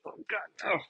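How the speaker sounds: high, grossed-out whine of disgust; disgusted groan. Retold or imitated. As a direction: shake it off like something gross.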